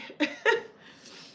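a high soundless laugh; airless laugh through tears. Real, not acted. Streamer laughing off her tears in front of chat, a high soundless laugh. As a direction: laugh it off while you're close to crying.

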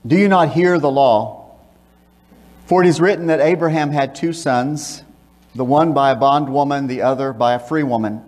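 usual speaking voice

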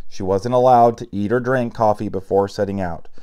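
ordinary delivery

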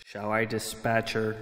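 soft, understated sinister offer; King the bird. A narrator's reading. King on Philip's shoulder asks to dispatch her, softly. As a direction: offer it softly, close to the ear. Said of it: "King fluttered up to Philip's shoulder".

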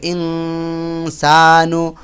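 ইনসা with the া stretched; slow, long ইনসা; elongated vowel. Shown as a demonstration. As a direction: draw out the া in ইনসা. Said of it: "টান হবে".

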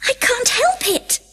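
Alice explaining to the Dormouse; Alice apologetic. A narrator's reading. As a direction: plead helplessly. Alice voiced.

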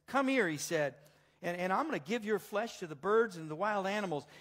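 lightly acted giant's threat; Goliath's threat. Goliath threatens David in the reading.

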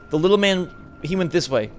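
normal talking voice

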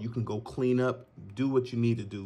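ordinary talk

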